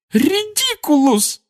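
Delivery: high, slow wailing shout; mother shouting the spell again through tears; crying shout. A narrator's reading. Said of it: "крикнула она опять".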